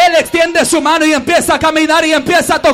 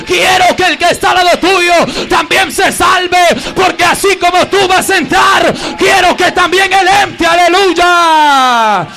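full-force preaching; 2 semitones higher, 4 dB louder than usual. Real, not acted. Pastor urging church to care for neighbours, full-force preaching. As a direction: plead for the neighbour's salvation.

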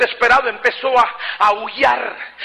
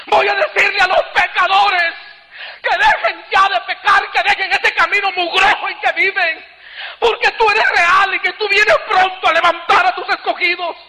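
crying while preaching; 11 semitones higher than usual. Real, not acted. Preacher begging the Lord to let him warn sinners, crying. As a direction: cry out the promise through tears. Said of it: "Mi llanto era continuo".

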